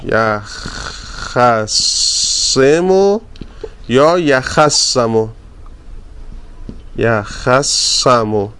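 یخ سمو said very slowly, twice with both vowels; slow drill. Shown as a demonstration. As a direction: draw out یخ سمو for the students. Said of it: "جفتش درسته". (یخ سمو را خیلی کشیده تکرار کن)

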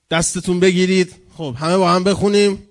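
loud prayer leader's summons; rally everyone loudly to join in; calling the congregation. Acted. (با صدای بلند جمع را فراخواندن)

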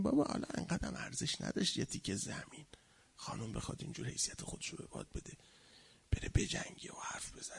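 whispered, grudging aside; secret inner thought. Acted. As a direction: whisper it like an unspoken complaint. Voicing people's secret inner thoughts. (نجوای درِ گوشی)

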